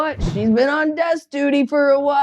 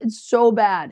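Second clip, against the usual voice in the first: ordinary talk, nothing marked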